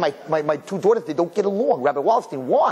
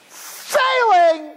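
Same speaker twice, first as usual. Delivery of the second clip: high, drawn-out mock announcement; exaggerated proclaiming; 4 dB louder than usual. Mocking teachers who plaster it on papers, exaggerated proclaiming. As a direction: proclaim it like a giant red stamp. Acting red stamp on paper.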